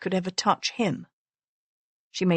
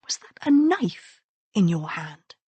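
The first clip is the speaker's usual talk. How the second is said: urgent whispered question; hushed, breathy whisper. A narrator's reading. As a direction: whisper it so passers-by can't hear. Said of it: "Messalina whispered".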